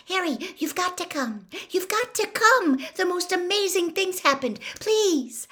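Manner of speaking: high-pitched excited pleading; Hermione, urgent. A narrator's reading. Hermione begging Harry to come, high urgent pleading. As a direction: plead urgently, pitched well above your own voice. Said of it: "she panted".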